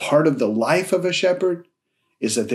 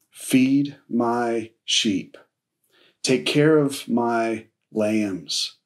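slow solemn quote; 2 semitones lower, much slower than usual. Acted. Quoting Jesus' words solemnly.

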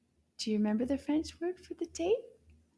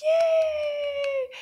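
long, high, loud "yay"; high-pitched celebratory whoop; excited cheer; 7 dB louder than usual. Pretend. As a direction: cheer the children's right answer. Cheers children's correct answer.

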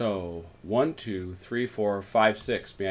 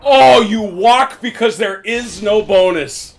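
excited; 9 semitones higher, 12 dB louder than usual. Real, not acted. Gloating exclamation.

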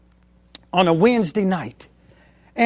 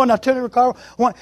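ordinary talk, nothing marked

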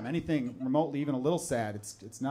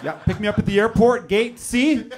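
bossy dad on the phone; 5 semitones higher, 8 dB louder than usual. Retold or imitated. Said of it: "some of them are getting phone calls being like".

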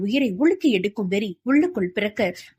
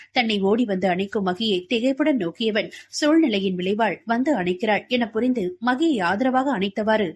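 ordinary delivery, nothing marked

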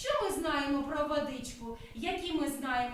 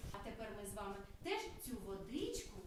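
hushed and quick, much softer; soft, fast; 6 semitones lower, 12 dB quieter, faster than usual. Real, not acted. Teacher hushes the children while leading the washing game. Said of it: "teacher leads washing game softly".

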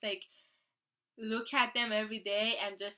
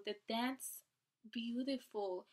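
ordinary delivery

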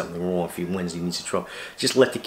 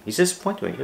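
"He's disappointed with me" repeated much higher, mocking and incredulous; sarcastic; 10 semitones higher, faster than usual. Real, not acted. Vlogger scoffing at a disappointed viewer, sarcastic high echo.

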